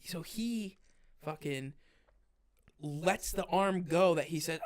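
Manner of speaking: ordinary delivery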